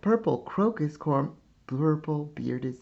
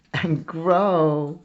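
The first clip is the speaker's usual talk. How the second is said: louder; 3 semitones higher, 7 dB louder than usual. Acted. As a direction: grow swells louder and higher. Repeated phrase, growing build.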